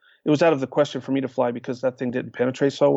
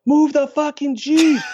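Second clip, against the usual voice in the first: very high, loud, slow angry shout; furious yell; 13 semitones higher, 5 dB louder than usual. Retold or imitated. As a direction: bellow it in exasperation. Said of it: "and then he goes".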